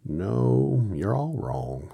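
plain reading voice

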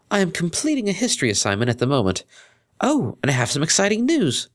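put-on high, proper young mare voice; polite friend over the phone; Octavia.

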